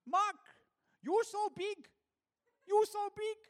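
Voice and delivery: imitating elderly Chinese tourists. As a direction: marvel up at the tall guide. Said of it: "they kept on saying".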